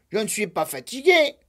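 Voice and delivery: loud, fast stamping insistence; cross childish insistence; insisting. Acted. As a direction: repeat it crossly. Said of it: "répéta Lapin".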